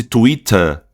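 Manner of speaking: "Twitter" slow, British T and no R; non-rhotic British accent. Shown as a demonstration. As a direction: end British "Twitter" on an open weak vowel. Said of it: "essa vogal fraca praticamente vai substituir".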